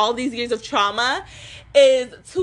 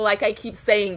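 ordinary talk, nothing marked

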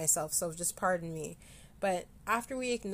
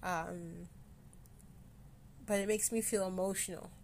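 getting emotional; 6 dB quieter than usual. Real, not acted. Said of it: "it actually started to come on".

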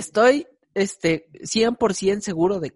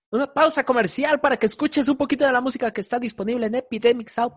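ad read; 8 semitones higher, 3 dB louder, faster than usual. Acted. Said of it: "Después de esta pequeña pausa comercial".

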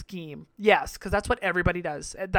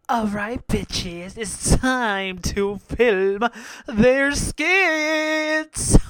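slow, louder show-host call; host announcement; 3 semitones higher, 7 dB louder, much slower than usual. Acted. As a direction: announce the next challenge. Hosting announcement.

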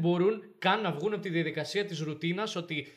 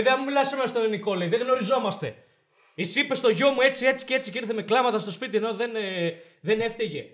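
louder, higher angry demand; angry father; 5 semitones higher, 5 dB louder than usual. Retold or imitated. Father bursting in on the principal, angry demand. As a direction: storm in and confront him.

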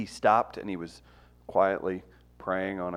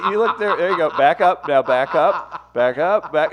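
calling out; 6 semitones higher, 9 dB louder than usual. Real, not acted. Directing the cameraman, laughing.

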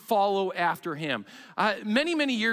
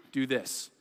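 low, soft instruction; hushed, understated command; quiet guiding voice; 10 semitones lower, 7 dB quieter than usual. Retold or imitated. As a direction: say it low and softly, as a gentle prompting. Holy Spirit's voice against deafening ones.

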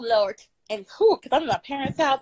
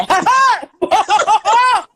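loud laugh; 11 semitones higher, 9 dB louder than usual. Real, not acted. Guest bursting in laughing.

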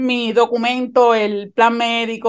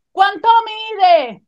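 much higher, slow drawn-out call; nurse shouting; 7 semitones higher, much slower than usual. Retold or imitated. Nurse calls the height question from behind, slow mock call. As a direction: stretch the question out across the room.

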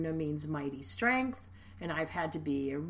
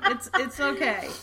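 laughing; 12 semitones higher, 13 dB louder than usual. Real, not acted. Laughing after a funny remark.

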